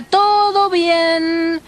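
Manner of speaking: very slow, long-drawn sung-out cry; lookout's call. Retold or imitated. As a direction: stretch it like a ship's watchman. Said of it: "la voz del vigía que decía". (grito alargado de vigía)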